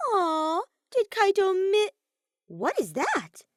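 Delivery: high, loud mock baby-talk coo. A narrator's reading. Kokichi mocks Kaito in a high coo. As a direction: coo like teasing a child.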